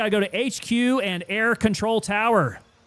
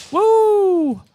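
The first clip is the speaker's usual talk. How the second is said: loud high whoop; excited whoop; 14 semitones higher, 8 dB louder than usual. Real, not acted.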